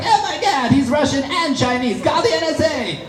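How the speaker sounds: very high-pitched mock panic; freaking out. Inattentive audience member reacting to the comic, freaking out. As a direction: squeal it like an alarmed audience member. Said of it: "they freak out".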